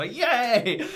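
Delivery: high, loud, laughing yay; excited cheer. Retold or imitated. Reactor showing viewers how they watch the video, excited cheer. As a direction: cheer like a delighted fan. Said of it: "I just watch it and go".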